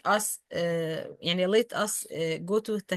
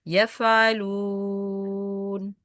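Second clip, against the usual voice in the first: slow يفعلون said with the nose pinched shut; slower than usual. Shown as a demonstration. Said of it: "close my nose and pronounce it easy".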